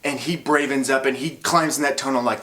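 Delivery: ordinary talk, nothing marked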